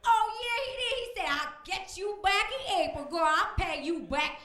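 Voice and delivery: loud, high, brash plaintiff; high loud sassy woman voice. Acted. A brash plaintiff ranting on a court show in a high loud voice. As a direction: shout the story up high like a fired-up witness. Latanya again.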